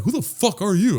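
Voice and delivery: high, incredulous demand; mock challenge. Relatives to the tall stranger at the wedding, mock challenge.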